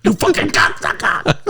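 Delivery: high, airy sleazy boss voice; Hollywood Tony voice. Acted. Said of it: "that's my thing".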